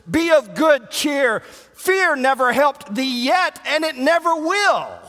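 high, loud, slow rousing sermon cry; rally the flock with a shout; thundering pulpit exhortation; full-force preaching. Acted.

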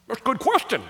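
a light mock voice, words said round a bite of corn dog; a hint of a crowd member eating while heckling; mouth full of corn dog. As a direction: play a guy chewing a corn dog as he asks it. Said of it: "great impersonation of a corn dog being eaten".

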